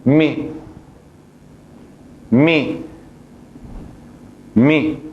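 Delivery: mi with kasra, short i like Spanish mi; Arabic syllable mi. Shown as a demonstration. Teacher drilling the kasra sound, short repeated mi. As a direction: repeat mi slowly, each one separate. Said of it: "con la kasra se pronuncia".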